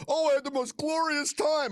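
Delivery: very high, hammed-up rapture; mock gushing. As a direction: parody someone raving about a vacation. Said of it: "I didn't come back and go".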